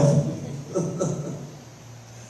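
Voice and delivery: amused laugh at his own story; real chuckling laugh. Real, not acted. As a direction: laugh as the anecdote lands.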